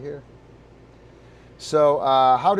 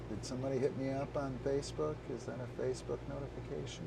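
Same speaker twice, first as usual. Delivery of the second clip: fast, much softer muttering; quick mumbled aside; 15 dB quieter, faster than usual. Real, not acted. Streamer checking a phone notification aside. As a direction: rattle it off under your breath.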